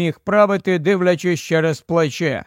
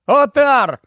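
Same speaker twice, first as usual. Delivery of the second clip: higher-pitched shout of a name; short, raised narrated shout; calling out; 7 semitones higher, 4 dB louder than usual. A narrator's reading. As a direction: call out to get his attention. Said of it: "крикнув Барні".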